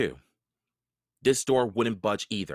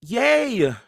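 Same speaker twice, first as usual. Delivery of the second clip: loud high cheer; girl cheering as they escape; shout; 14 semitones higher, 9 dB louder than usual. A narrator's reading. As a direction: shout for joy into the sunlight. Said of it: "Annie shouted".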